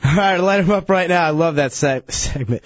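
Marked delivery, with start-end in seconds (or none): none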